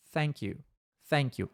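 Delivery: "thank you" com língua entre os dentes no TH, mais suave; TH correto. Shown as a demonstration. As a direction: mostra o som TH de "thank you" com clareza. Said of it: "esse som aqui, ó". ("thank you" com TH interdental)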